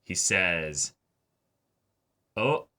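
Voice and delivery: ordinary delivery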